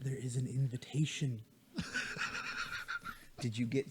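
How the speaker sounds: low, soft and close; soft close-mic voice. Acted. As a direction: lean in and say it softly. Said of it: "grab my mic and lean it in".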